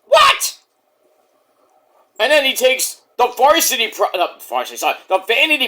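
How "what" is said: high, loud stunned "holy shit, what"; much higher, squeaky shocked cry; shocked yell. Retold or imitated. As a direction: yelp it out in disbelief. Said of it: "I'm like".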